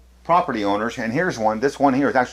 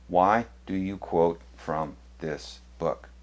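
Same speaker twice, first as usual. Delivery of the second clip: slow, low and softer, word by word; slow, pointed question; 4 semitones lower, 5 dB quieter, slower than usual. Real, not acted. Challenges Adventists on quoting this book, slow and pointed. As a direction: spell out the challenge slowly, dropping the voice.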